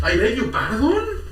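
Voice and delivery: hammed-up shocked aristocrat; mock posh indignation. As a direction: clutch the pearls in disbelief. Mock outrage at filming story.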